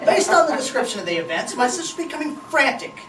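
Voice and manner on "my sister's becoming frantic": plain reading voice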